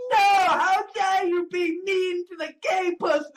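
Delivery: high-pitched indignant cry; hammed-up scandalised protest; mock outrage. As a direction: imitate an offended defender, pitched right up. Acts out people objecting.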